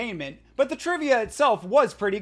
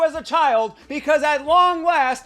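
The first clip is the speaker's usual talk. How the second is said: excited; 5 semitones higher, 5 dB louder than usual. Real, not acted. Building hype for the fight.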